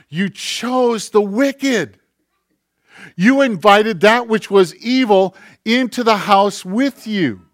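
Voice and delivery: fiery preaching. Acted. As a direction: press the crowd hard from the pulpit. Preaching at full force.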